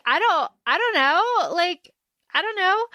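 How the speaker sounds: high-pitched, helpless mock "dunno"; acted bafflement. Retelling her answer to his question, acted bafflement. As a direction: pitch it up, playing clueless.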